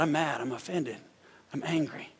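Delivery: ordinary talk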